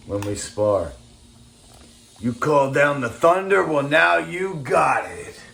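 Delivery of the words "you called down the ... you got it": movie villain threat. Pretend. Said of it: "movie quote threat".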